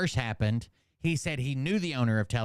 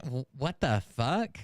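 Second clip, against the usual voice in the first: normal talking voice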